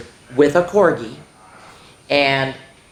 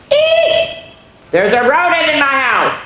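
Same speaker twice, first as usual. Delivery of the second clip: much higher, louder hammed-up shriek; 12 semitones higher, 7 dB louder than usual. A narrator's reading. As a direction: squeal like a frightened mama. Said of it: "Mama shrieks".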